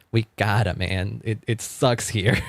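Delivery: ordinary talk, nothing marked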